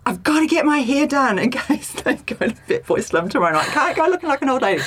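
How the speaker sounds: hammed-up rush to the hairdresser; mock frantic. Telling friends her frantic inner voice, mock panic and laughing.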